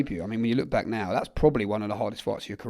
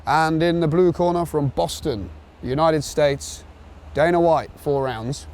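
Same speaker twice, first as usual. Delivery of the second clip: ring announcer; 5 semitones higher, slower than usual. Pretend. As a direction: announce the fighters like fight night in the ring. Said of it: "Four rounds, Madison Square Garden".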